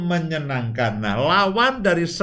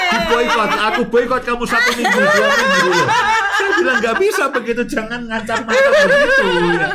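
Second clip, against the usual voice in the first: squealing with laughter, much higher and louder; laughing high and loud; 14 semitones higher, 6 dB louder, faster than usual. Real, not acted. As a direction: laugh through the words, pitched way up. Laughing about wife's sulking threat.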